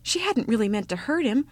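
ordinary delivery, nothing marked

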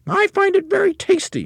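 very high, sly witch voice; witchy old woman. Acted. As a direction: purr it like a gloating witch. Wife's voice in story.

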